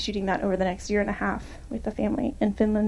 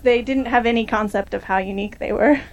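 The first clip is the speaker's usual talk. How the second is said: ordinary talk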